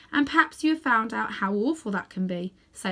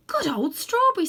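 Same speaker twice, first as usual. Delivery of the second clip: put-on fond girl's voice; 10 semitones higher, 5 dB louder than usual. A narrator's reading. Polly greets the horse.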